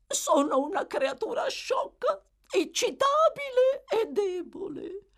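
high, wailing, self-pitying lady; tearful matron. A narrator's reading. Mrs Corney confiding in Bumble, tearful. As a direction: bemoan yourself in a high, fragile voice.

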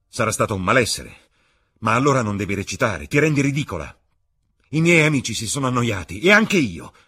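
loud, rising cruel scolding; contemptuous anger. Acted. (Dorian rimprovera Sibyl, sempre più forte)

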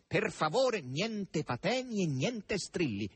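high, drawn-out reproof; slow down and forbid any fuss; clouding over. A narrator's reading. Azasello warns Margherita against outcries, clouding over.

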